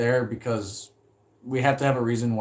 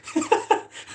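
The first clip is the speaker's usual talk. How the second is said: airy high-pitched chuckle; high breathy laugh. Retold or imitated. Imitating the landlord's laugh at his tenant, breathy and high. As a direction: mimic the landlord's wheezy laugh. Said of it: "He does this face".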